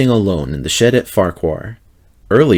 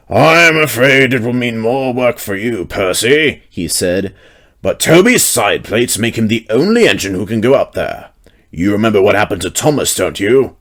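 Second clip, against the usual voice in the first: Fat Controller. A narrator's reading. Fat Controller voiced in story.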